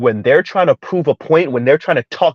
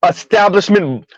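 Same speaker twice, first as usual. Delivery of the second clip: ordinary delivery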